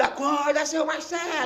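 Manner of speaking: very high, stretched-out calling voice; imitated nurse's yell. Pastor re-enacts nurse waking him, high mock yell. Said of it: "Gritou no meu ouvido".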